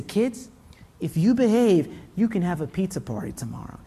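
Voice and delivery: lightly voiced teacher, soft and low; imitated teacher calming the class; coaxing teacher. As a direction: quietly bargain with rowdy kids. Teacher bargains to calm kids down.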